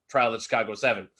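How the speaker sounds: ordinary talk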